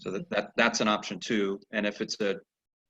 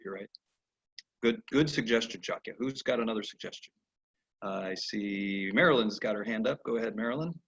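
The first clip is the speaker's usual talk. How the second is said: ordinary talk, nothing marked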